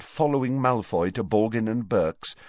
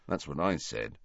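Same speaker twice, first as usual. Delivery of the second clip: much softer low grumble under breath; muttered; 4 semitones lower, 7 dB quieter than usual. A narrator's reading. Ron grumbling aside to friends, muttered. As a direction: say it half to yourself. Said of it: "muttered Ron".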